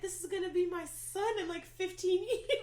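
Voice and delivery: very high, loud emotional outburst; overwhelmed gushing. Retold or imitated. Imagining her own son graduating someday, overwhelmed gushing. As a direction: build to an overwhelmed, hammed-up wail.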